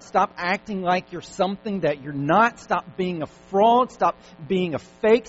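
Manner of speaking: loud, high, drawn-out exhortation; forceful preaching. Acted. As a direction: hammer each command at the congregation.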